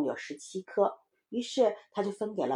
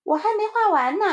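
put-on high, loud kid's retort; protesting little child; 13 semitones higher, 8 dB louder than usual. Child answers playmate's criticism. (小孩高声辩解)

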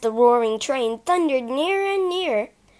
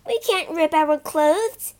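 slow, higher protest; objecting; 6 semitones higher, 5 dB louder than usual. Acted. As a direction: object firmly. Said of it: "Phyllis objected".

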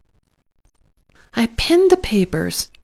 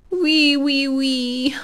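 high giggly little piglet squeal; giggling piglet; 7 semitones higher, much slower than usual. Acted. Alexander the piglet giggles at his mother. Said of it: "giggled Alexander".

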